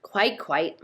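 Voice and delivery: plain reading voice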